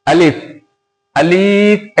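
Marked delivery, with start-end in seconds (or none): 1.2-2.0 अलीफ with the second vowel stretched long; drawn-out mispronunciation. Shown as a demonstration. Teacher showing pupils how not to say it, drawn out. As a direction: stretch अलीफ out as the wrong example. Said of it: "ऐसा नहीं बोलेंगे".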